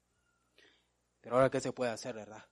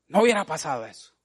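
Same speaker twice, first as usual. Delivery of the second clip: fuming Marta; 3 semitones higher, 5 dB louder than usual. Retold or imitated. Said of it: "le hervía la boca a Marta".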